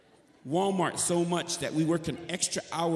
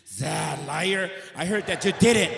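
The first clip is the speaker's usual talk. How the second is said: rising to a loud, higher accusation; accusing shout; 3 semitones higher, 6 dB louder than usual. Retold or imitated. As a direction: call him a liar, louder each word. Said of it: "She says".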